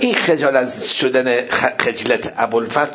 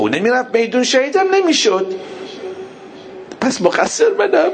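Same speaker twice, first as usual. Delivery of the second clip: high wail breaking into sobs; anguished lament; 11 semitones higher than usual. Retold or imitated. Abbas blaming himself for the child's death, anguished wail. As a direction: cry out the guilt, climbing higher.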